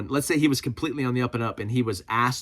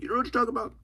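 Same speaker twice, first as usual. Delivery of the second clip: mock commenter; 12 semitones higher, much faster than usual.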